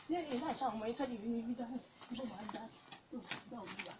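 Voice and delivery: very quiet, low fretting prayer; frightened muttering. Acted. Evangelist hiding and reciting to God, frightened muttering. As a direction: murmur anxiously to God while hiding.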